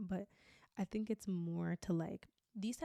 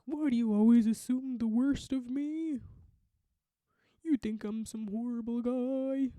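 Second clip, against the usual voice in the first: loud, higher, drawn-out mock indignation; wounded deflection; 4 semitones higher, 7 dB louder than usual. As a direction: play the hammed-up wronged partner, dragging out the protest. Acted partner deflecting accusation.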